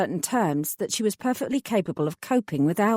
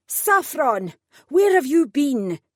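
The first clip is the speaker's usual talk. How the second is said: higher, louder call, slow on the question; bellowing across the room; 6 semitones higher, 3 dB louder, slower than usual. A narrator's reading. Adeline calling Saffron from across the room, a bellow. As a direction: shout her name across the office, demanding. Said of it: "Adeline bellowed at me".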